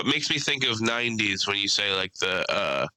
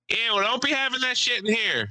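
high, mock scolding old lady; old lady warning the overdue girlfriend; sassy old lady; 12 semitones higher than usual. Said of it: "She's like".

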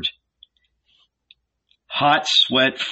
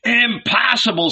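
angry; 12 semitones higher than usual. A narrator's reading. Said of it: "growing angry".

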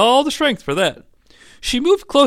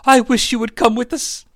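high-pitched acted lament; tearful mom; 8 semitones higher than usual. Mom leaving Glenn behind, acted crying. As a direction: plead as the crying mother. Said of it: "his mom was crying".

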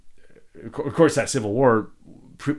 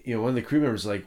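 normal talking voice

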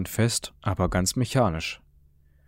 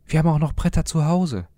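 called out; 8 semitones higher, 4 dB louder than usual. A narrator's reading. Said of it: "rief er".

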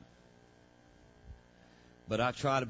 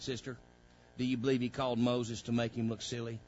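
usual speaking voice

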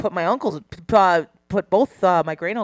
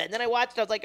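usual speaking voice

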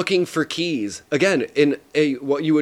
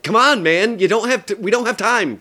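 higher, louder, urging someone on; urgent frustration; 7 semitones higher, 4 dB louder than usual. Retold or imitated. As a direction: hurry them, exasperated. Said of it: "you have that feeling of".

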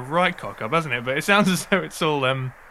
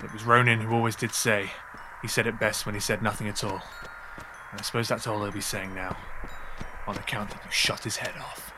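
lower, softer villain, brooding; grim outlaw; 5 semitones lower, 6 dB quieter than usual. Acted. Anton blaming the boys for Ronan's death, low and brooding. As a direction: let the menace sink low and quiet. Villain mourns then accuses.

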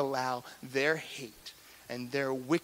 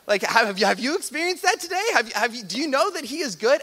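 fervent; 10 semitones higher, 8 dB louder than usual. Real, not acted. Preacher challenges the congregation.

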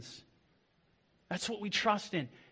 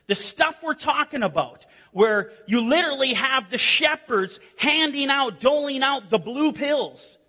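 rising, louder sermon build; fiery preaching; 5 semitones higher, 5 dB louder than usual. Acted. Pastor warning church about false shepherds, fiery preaching. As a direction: preach it hard to the pews.

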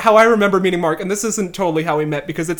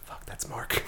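hushed, voiceless whisper; thought to self; 19 dB quieter, slower than usual. Retold or imitated. Student recognizing the backstage laugher, whispered inner thought. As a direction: whisper it like a secret realization. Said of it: "in my head, I'm like".

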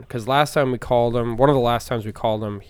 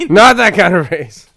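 higher, louder, slower punchline; mock retort; 7 semitones higher, 12 dB louder than usual. Boss turning down the coder's raise, hammed up. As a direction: deliver the rebuff with relish. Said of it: "I need a raise".

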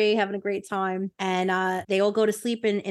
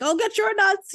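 much higher, hammed-up threatening cry; mock threat; 12 semitones higher, 3 dB louder than usual. Hero waves his sword at the guards, hammed-up high threat. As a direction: squeal the threat in jest.

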